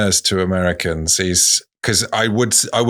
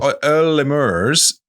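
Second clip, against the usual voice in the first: put-on slow posh English accent; 5 semitones higher, slower than usual. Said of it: "saying [name] in a posh voice".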